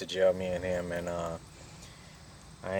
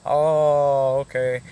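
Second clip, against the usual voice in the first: loud, higher, drawn-out dawning "oh"; mock realization; 6 semitones higher, 8 dB louder, much slower than usual. Authorities hearing his self-defense claim, suddenly satisfied. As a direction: imitate officials suddenly getting it. Retold officials accepting his explanation.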